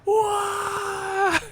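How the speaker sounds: very high, drawn-out wail; mock outrage. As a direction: imitate a hater's shrieked disbelief. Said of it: "angry when they see that".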